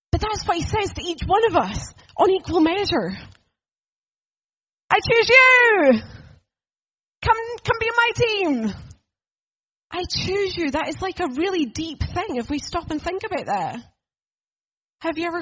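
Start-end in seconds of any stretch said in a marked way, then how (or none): 4.9-10.7 loud, much higher, slow and swelling invitation; fervent call. Acted. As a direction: call out with open arms, then ease off. Jesus calling each one.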